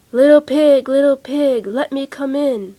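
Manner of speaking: loud, menacing wolf call; big bad wolf. The wolf demands entry, loud menacing call. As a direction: call through the door like a wolf.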